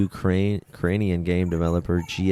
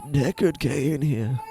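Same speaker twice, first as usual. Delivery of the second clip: toned-down, slow character voice, raised in pitch; Deckard Cain; 6 semitones higher, much slower than usual. Acted. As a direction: play the old game character with restraint, no shouting. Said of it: "probably doesn't even sound like that".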